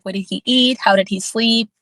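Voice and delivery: ordinary talk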